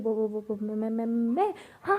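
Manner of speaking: stuck repeating the first word; flustered stammering. Acted. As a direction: stammer for an excuse, then burst out. पकड़ी गई भावना हकलाती है. (हकलाते हुए घबराया जवाब)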